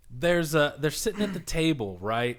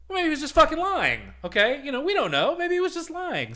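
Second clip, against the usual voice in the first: much higher and louder, insisting; worked-up rant; 13 semitones higher, 4 dB louder than usual. Real, not acted. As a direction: get fired up arguing he was lying. Excited rant about Kylo lying.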